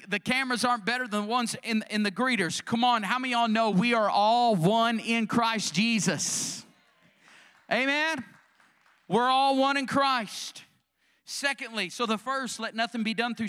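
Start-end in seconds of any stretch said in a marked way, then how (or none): 2.6-10.6 loud rising preacher's call; full-force preaching. Acted. Shout it out to the congregation. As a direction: pastor rallies the church toward unity, preaching at full force.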